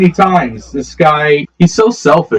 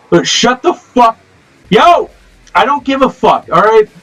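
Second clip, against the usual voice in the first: angry yelling; 8 semitones higher than usual. Real, not acted. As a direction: shout back at the interruption. Yelling at mom off-camera.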